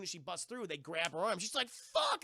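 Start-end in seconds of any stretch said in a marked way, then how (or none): 1.7-2.2 high-pitched mock cry of alarm; freaking out. Grabbed character starts freaking out, mock panicked yelp. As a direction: yelp it in a much higher voice, imitating panic. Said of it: "They start freaking out".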